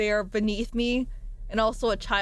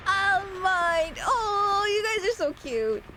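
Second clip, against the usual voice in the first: gushing; 11 semitones higher, much slower than usual. Real, not acted. Touched by chat's sweet messages.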